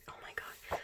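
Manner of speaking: soft hushed gasp; soft starstruck whisper; awed whisper. Real, not acted. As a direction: whisper it, lovestruck. Gushing over idol photos.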